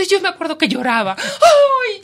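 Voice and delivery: high joyful laughing cry. Retold or imitated. Reporter reliving tears of joy, high laughing cry. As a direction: let it break into a cry of joy.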